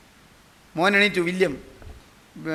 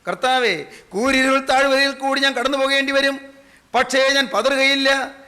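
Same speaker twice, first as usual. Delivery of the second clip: ringing full-voice preaching; fervent exhortation; 7 semitones higher, 5 dB louder than usual. Real, not acted. Preacher calling congregation to decide today, forceful declaiming. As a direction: proclaim it with unwavering force, stretching the last words.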